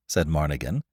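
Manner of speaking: plain reading voice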